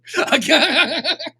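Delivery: loud, high peal of laughter; bursting out laughing. Acted. Character rolling along after the storm story, bursting out laughing. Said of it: "She bursts out laughing as she rolls along".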